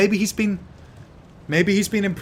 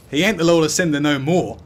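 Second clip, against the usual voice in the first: usual speaking voice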